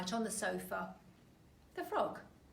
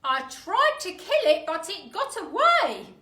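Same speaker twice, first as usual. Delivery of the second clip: higher, loud mock-alarmed old man; Mr Twit voice; 9 semitones higher, 18 dB louder than usual. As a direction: scare her with fake alarm. Mister Twit scaring wife.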